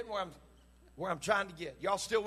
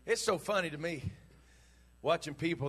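normal talking voice

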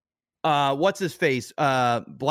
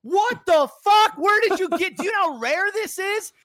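very high, loud, laughing yells of disbelief; stunned entourage; 3 dB louder than usual. Retold or imitated. Entourage freaking out at the interviewer's find, very high shocked yelling. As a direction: scream in shock at the rare item, pitched far up. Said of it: "The entourage's like".

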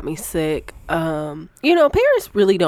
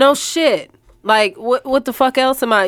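indignant; 8 semitones higher, 6 dB louder than usual. Real, not acted. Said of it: "repeats louder".

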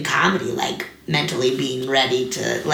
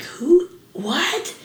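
starstruck disbelief; 6 semitones higher, slower than usual. Retold or imitated. First seeing Chris Farley on SNL, retold with high starstruck awe. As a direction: relive first seeing him, dazzled. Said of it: "I was like".

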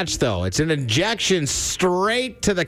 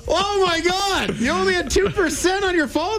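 mock shock. Cohost teasing the caller about her dying phone, mock shock. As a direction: ham up the astonishment.

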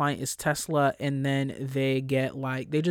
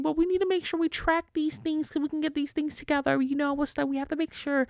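higher, whiny imitation; mock anxious colleague; 12 semitones higher than usual. Mimicking an anxious colleague insisting on the tracker, whiny and high. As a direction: whine it like a worried coworker.

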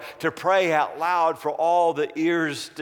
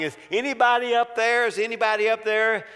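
high, quick cry for help; mock-frantic shout; acted shouting; 7 semitones higher, faster than usual. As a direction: call up urgently from below. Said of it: "he started shouting".

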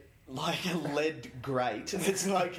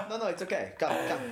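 higher, lightly put-on old man; old Greek man; 5 semitones higher, faster than usual. Old Greek man brushing off the plumber, hammed-up. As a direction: wave it off like a stubborn old man. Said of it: "silly old Greek men just going like".

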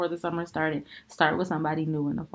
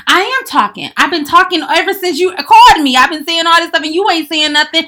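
high, loud, heated snap back; angry retort; 10 semitones higher, 12 dB louder than usual. Retold or imitated. To a boyfriend on the phone, loud angry retort. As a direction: fire back in a phone fight.

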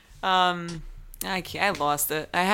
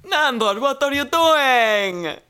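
loud, high, slow drawn-out vampire call; vampire impression; 6 semitones higher, 6 dB louder, much slower than usual. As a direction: stretch out "doing" like a theatrical vampire. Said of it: "you sound more like Count Chocula".

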